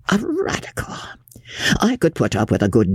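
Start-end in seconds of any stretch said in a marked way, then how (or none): 0.1-1.0 very high, softer outraged echo; affronted disbelief. Acted. Lawyer stung at being called radical, incredulous. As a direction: echo the insult in appalled disbelief.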